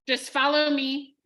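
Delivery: ordinary delivery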